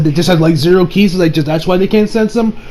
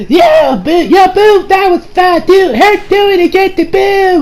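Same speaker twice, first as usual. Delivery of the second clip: put-on high, childlike gleeful voice; gleeful Boo; 13 semitones higher, slower than usual. Boo begging the fighter to do it again, high gleeful voice. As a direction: play it like a delighted kid asking for more. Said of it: "he's like".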